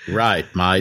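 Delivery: put-on brief jokey Aussie accent; mock Australian accent. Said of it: "we're never gonna sound Australian".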